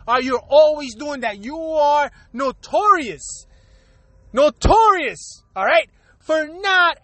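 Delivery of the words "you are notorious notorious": notorious said slow and weighty, each syllable leaned on; stress on notorious. Real, not acted. Scolding people who never answer, slow heavy notorious. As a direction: drag out notorious slowly to nail the accusation.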